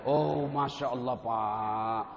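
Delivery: drawn-out, slow, almost sung exclamation; kagum penuh syukur. Acted. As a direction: stretch it out in grateful wonder. Overjoyed reaction to small gift. (berseru panjang penuh syukur)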